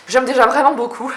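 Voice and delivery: laughing happily as she admires it; laughing while talking. Real, not acted. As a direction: giggle your delight. Delighted about her new notebook.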